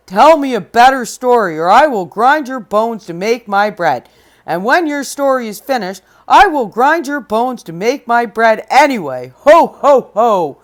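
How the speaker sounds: loud, threatening giant; menacing giant. Acted. Giant squeezing Jack and threatening him, loud menace. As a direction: loom over him and gloat. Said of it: "The giant laughed an ugly laugh".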